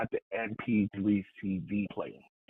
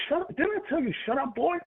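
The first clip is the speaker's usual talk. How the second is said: much higher, imitating a cop snapping at him; mock cop order. Officer telling the teen to shut up, mocking high voice. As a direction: voice the officer shouting him down, pitched way up. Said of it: "He said".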